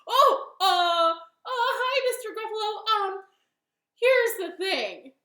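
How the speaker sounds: high, flustered stammering; acted fluster, bursting out then hesitating; nervous bunny. Startled bunny stalls the Gruffalo.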